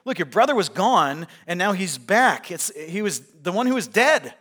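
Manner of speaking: loud, high urgent servant's report; excited servant. Retold or imitated. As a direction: blurt the news to the older brother. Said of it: "He says".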